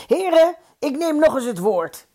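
high, projected announcement; calling out. A narrator's reading. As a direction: call the gentlemen to attention. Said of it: "riep hij".

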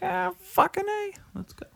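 squeaky high mock hype; pumped friend saying yes; enthusiastic yes. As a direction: shoot way up in pitch, eager. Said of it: "Be like".

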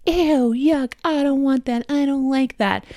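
high, whiny imitation of a disgusted kid; rude disgust. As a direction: mimic a child rudely rejecting food. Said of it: "much more polite than".

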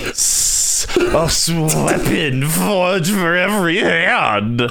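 high, slow sultry voice; breathy slow seductive read; sexy voice. Pretend. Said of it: "that's my sexy voice".

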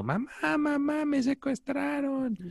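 high soft mock pleading, slowed; fake kidnap victim. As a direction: whimper it like a fake hostage. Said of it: "el típico que te habla diciendo".

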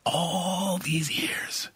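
slow unvoiced whisper; slow stunned whisper. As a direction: let it out on breath alone, slowly. Cora repeats, stunned.

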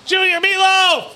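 high, slow, loud shout of the name; shouted call. Real, not acted. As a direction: belt it out, drawn long. Calling friend's nickname.

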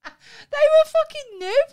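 laughing. Real, not acted. Friend laughs about Paul giving away brand new shoes. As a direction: laugh through it in disbelief.

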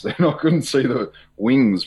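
laughing nervously through the words. Real, not acted. Guest recalling angels, laughing nervously. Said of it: "I'm laughing nervously now".

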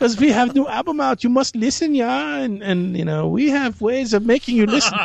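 high, insistent mock German accent; hammed-up German interrogator. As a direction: demand attention as a comic German. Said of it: "my only German accent I can do".

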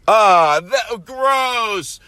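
high shouted revulsion; hammed-up squeal of disgust; mock disgust. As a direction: cry out grossed-out, stretching GROSS. Mock revulsion at fan email.

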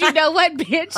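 high, loud retold scolding; exasperated telling-off. Retelling a row with Crystal during the panic attack, high exasperated scolding. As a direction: act out losing patience, pitched right up.

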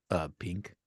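low, quiet, hesitant answer; sheepish repeat. Acted. Superman repeating his answer when questioned, sheepish and quiet. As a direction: drop right down and mumble it hesitantly.

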